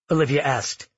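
plain reading voice

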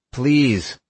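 desperate plea. Acted. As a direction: beg with everything left. Begging aliens to save family.

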